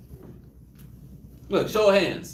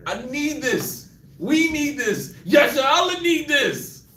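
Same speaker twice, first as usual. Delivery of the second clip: pleading prayer; 6 semitones higher, slower than usual. Retold or imitated. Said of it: "you're like".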